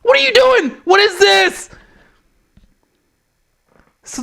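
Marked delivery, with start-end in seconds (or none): 0.0-1.7 mock a baffled outburst at her partner; exasperated rant. Dakota Kai yelling at her partner in the ring, high and exasperated. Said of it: "and she'd just like".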